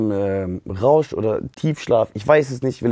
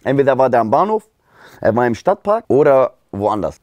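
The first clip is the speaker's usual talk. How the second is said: usual speaking voice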